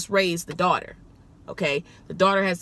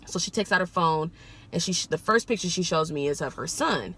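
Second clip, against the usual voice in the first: ordinary talk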